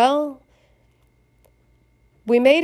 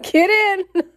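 casual order; 10 semitones higher, 3 dB louder than usual. Retold or imitated. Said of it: "he's like".

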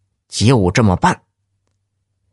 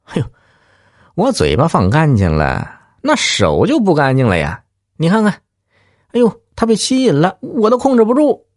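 high, fast leering mock voice; grinning and shameless; 9 semitones higher than usual. Lout keeps taunting the woman, high leering voice. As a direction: play the sleazy lout, rising higher and quicker. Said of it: "仍是嬉皮笑脸地说".

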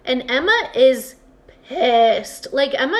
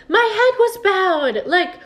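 squeaky, giggling protest; high laughing excuse; 8 semitones higher, 5 dB louder than usual. Retold or imitated. Alfween excuses herself, laughing.

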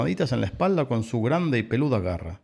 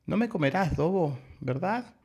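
high, small pleading voice; little lamb; 7 semitones higher than usual. A narrator's reading. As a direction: ask like a tiny lamb seeking a promise. Lamb timidly asks for reassurance. (vocecita aguda de ovejita)

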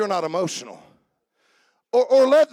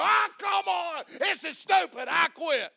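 higher-pitched exasperated yell, hammed up; mock golf outburst; 8 semitones higher, 7 dB quieter than usual. Preacher acting out his own golf-course tantrum, hammed-up yell. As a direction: blow up like a golfer who just shanked it. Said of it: "I go".

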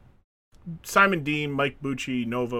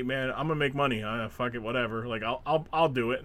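softer, lower, giving in; resigned mumble; 5 semitones lower, 8 dB quieter than usual. Retold or imitated. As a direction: shrug it off quietly. Voicing resigned wrestler.

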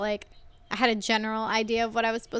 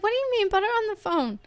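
much higher mom voice, urgent; alarmed mom; 10 semitones higher, faster than usual. Retold or imitated. Mom on the phone hearing about the bottle, alarmed and high. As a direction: demand the phone like a worried mother. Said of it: "And my mom's like".

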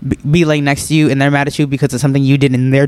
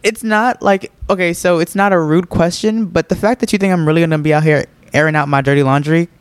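ordinary talk

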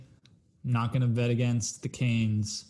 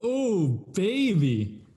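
excited; 6 semitones higher, much slower than usual. Real, not acted. Reacting to bold bet.

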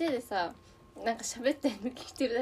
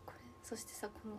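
whispering; 16 dB quieter than usual. Real, not acted. Whispering about the dressing.

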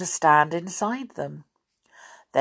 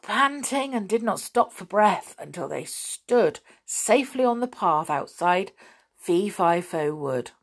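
ordinary delivery

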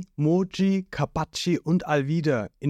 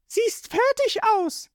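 put-on much higher, slow cool girl's voice; Nami's voice; slower than usual. A narrator's reading. Nami looking down at Zorro, high and cold.

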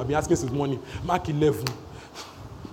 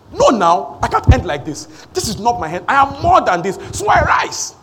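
loud, defiant declaration climbing higher; fighting talk; 3 semitones higher, 5 dB louder than usual. Acted. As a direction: fight back out loud, rising to a peak. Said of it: "not every talk is calm talk".

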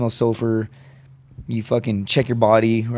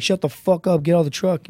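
higher-pitched retold command; barked order; 6 semitones higher than usual. Marine ordering a comrade out of the truck, barking. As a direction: snap an urgent order at a comrade.

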